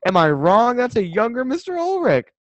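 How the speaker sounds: loud, high-pitched exclamation with stretched words; amazed outburst. Real, not acted. Friend spotting a teacher's lookalike in a photo, amazed outburst. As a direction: cry out in disbelieved recognition.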